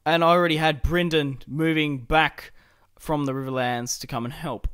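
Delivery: ordinary delivery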